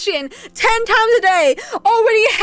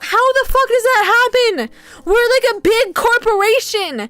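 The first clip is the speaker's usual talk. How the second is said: exasperated rant; 6 semitones higher than usual. Retold or imitated. Quoting her own reaction to dead work phones, exasperated. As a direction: vent the disbelief at the company. Ranting quote about disconnected phones.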